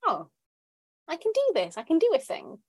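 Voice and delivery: high, bright burst of pleased surprise; delighted self-surprise. Retold or imitated. Surprising herself that she can cope, pitched high and delighted. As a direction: squeak it out like you just amazed yourself.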